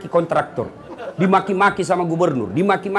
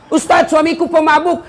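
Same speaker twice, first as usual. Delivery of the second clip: complaining wife; 11 semitones higher, 5 dB louder than usual. Retold or imitated. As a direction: whine to the preacher about the husband.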